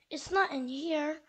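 usual speaking voice

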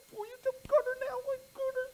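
put-on high, whiny childish glee; gloating boy. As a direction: crow over it like a sneaky kid. Steve gloats again.

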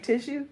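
tissue ending in a clear shoe; full tissue. Shown as a demonstration. Checking the word, tissue with full ending. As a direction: say tissue as tish-oo. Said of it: "we say tissue".

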